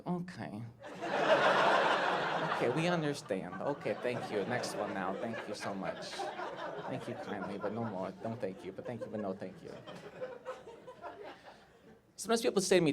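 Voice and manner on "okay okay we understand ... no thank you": soft, low, polite dismissal; imitated underwhelmed audience, hushed and low; unimpressed crowd. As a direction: gently wave the comedian away. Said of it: "when the crowd's like".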